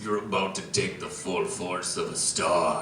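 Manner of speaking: very low, hushed dramatic delivery; deep and quiet, a grave movie warning; dramatic Peter Dinklage. Acted. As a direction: intone it low and soft, with portent. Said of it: "he looks at Thor and he says".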